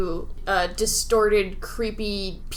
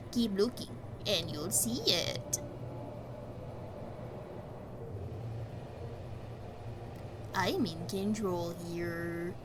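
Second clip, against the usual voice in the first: soft, sinister storyteller voice, softer and unhurried; creepy bedtime storyteller; 3 semitones lower, 11 dB quieter than usual. Acted. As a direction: lull a child to sleep while hinting at a menace. Reads Doctor Habit's bedtime story.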